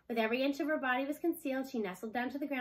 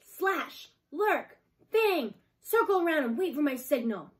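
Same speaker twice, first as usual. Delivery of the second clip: commanding; 5 semitones higher, 7 dB louder, slower than usual. A narrator's reading. Wolf leader commands pack.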